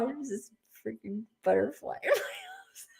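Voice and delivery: breaking into laughter mid-sentence; laughing. Real, not acted. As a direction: laugh through the words. Laughing at butterfly interrupting.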